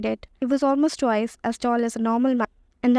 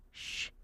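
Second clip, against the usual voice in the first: sharp hissed "shh"; hissed shush; 20 dB quieter than usual. A narrator's reading. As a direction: hush them with a hissing shh. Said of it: "hissed Professor McGonagall".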